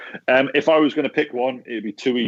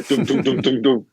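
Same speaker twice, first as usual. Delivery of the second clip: gavel knocks; 3 semitones higher than usual. Pretend. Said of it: "knockers from an auctioneer".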